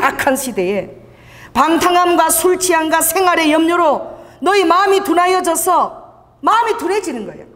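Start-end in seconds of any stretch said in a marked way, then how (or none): 1.5-6.1 slow, weighty verse reading; solemn preaching; scripture recitation. Acted. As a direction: stretch the last word. Quoting scripture.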